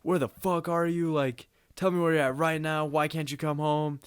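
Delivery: high-pitched, insistent impression of an angry parent; imitated freaking-out dad. Dad texting son who won't come home, imitated freak-out. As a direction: mimic dad demanding answers, pitch held up. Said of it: "He's just, like, freaking out over text".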